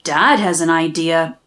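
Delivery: ordinary delivery, nothing marked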